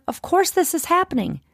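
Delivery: of course said high and falling; coaching phrase. Shown as a demonstration. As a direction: hand her the phrase lifted high. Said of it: "you just remember".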